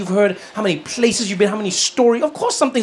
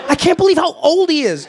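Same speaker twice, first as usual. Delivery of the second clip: high-pitched, appalled gossip; mock shock; 7 semitones higher than usual. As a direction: imitate scandalised people gossiping. Mimics appalled critics of Biden.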